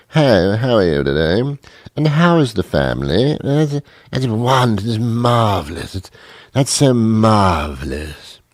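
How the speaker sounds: hammed-up genteel small talk; mock-refined, languid tea-party voice; subdued, proper tea drinker. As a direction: play a very proper tea drinker, drawing out the words. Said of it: "They are very subdued and very proper".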